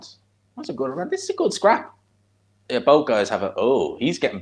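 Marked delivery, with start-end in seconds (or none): 0.0-1.1 much higher, softer put-on falsetto; squeaky mock voice. Praising the round to viewers, in a squeaky falsetto. Repeats line in odd voice. 1.1-1.9 squeaky mock voice. Praising the round to viewers, in a squeaky falsetto. Keeps silly voice going.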